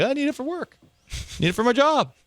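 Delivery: high, whiny voice begging for pills; pleading patient. Pretend. Person pleading with a doctor for Adderall, high and whiny. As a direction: whine it up high like a needy patient. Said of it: "You just say, like".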